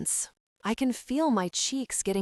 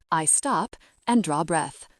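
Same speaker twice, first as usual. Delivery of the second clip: ordinary delivery, nothing marked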